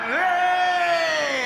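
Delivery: loud high hey thrown to the crowd; shouted greeting. Real, not acted. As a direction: call out to the fan at the front. Greeting fan down front.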